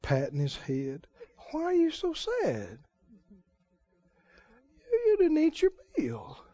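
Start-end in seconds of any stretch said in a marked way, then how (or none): 1.5-6.4 high, soft pampering voice; high doting wife coo. Acted. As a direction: pamper him gently. Jezebel petting husband.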